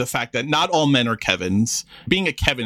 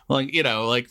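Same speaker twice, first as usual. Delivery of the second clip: ordinary talk, nothing marked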